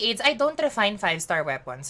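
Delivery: ordinary delivery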